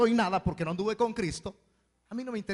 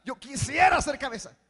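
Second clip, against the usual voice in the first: mock wishing; 6 semitones higher, 6 dB louder than usual. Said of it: "Nunca diga".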